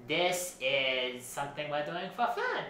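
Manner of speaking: lilting, theatrical designer character voice; fussy costume designer Edison. Acted. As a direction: play a self-important fashion designer, pitch swooping. Plays Edna Mode's cousin Edison.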